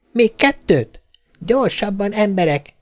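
put-on high, mildly officious child voice; self-important. A narrator's reading. Little girl ordering her pretend crew, self-important. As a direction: play at commanding a crew. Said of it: "tette hozzá fontoskodó hangon".

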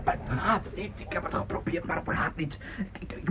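whispered, broken, hoarse voice; hoarse despair. Acted. The hoarse singer tells them his voice won't work. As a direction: barely get the words out. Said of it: "ik ben nog hees".

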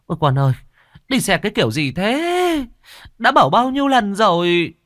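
high, loud tearful scolding of a son; anxious mother scolding her fallen son, tearful and high. Acted. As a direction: near tears, scold him as you run. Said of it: "vừa chạy bà vừa rơm rớm".

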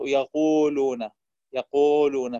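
يقولون with lips pressed hard and extra air on the waw; overpressed lips. Shown as a demonstration. Teacher demonstrating over-pressed breathy waw in يقولون. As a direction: squeeze the lips on يقولون, slow. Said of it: "المبالغة في الضغط على الشفتين".

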